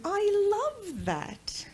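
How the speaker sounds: high, slow, drawn-out gush; gushing adoration. Retold or imitated. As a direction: coo exaggerated praise to a small child. Repeats her gushing praise to son.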